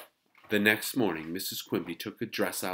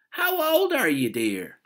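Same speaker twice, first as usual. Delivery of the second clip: very high, loud, cooing question; patronizing neighbor; 13 semitones higher, 14 dB louder, slower than usual. A narrator's reading. Neighbor asks a little girl her age, patronizing high voice. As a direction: talk down to a child in a put-on high voice.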